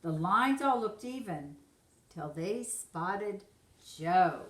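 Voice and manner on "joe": usual speaking voice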